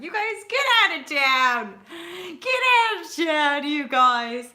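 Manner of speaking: high, loud, playful cry; excited exclamation. Real, not acted. Exclaiming to viewers after laughing, excited high shout. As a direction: shout it out in delighted disbelief.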